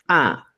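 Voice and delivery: A begun with a popped ejective p; reinforced p. Shown as a demonstration. Teacher reaching reinforced phoneme, popped A. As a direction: pop the lips sharply into A. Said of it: "llegas al fonema reforzado".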